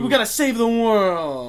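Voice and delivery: high, loud, slow mock hero; mock heroic voice. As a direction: ham up a noble hero line. Mocking hero line in mock voice.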